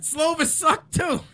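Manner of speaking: high, slow, laughing jab; teasing jab. Real, not acted. Joking jab.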